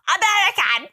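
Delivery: high, exaggerated American "American!"; mock American accent. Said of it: "how would I ever say American like that".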